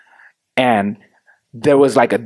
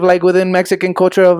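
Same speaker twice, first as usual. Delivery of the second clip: ordinary talk, nothing marked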